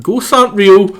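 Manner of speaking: loud indignant shout. Acted. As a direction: yell it in disbelief. Outburst in game dialogue.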